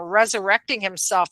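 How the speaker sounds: normal talking voice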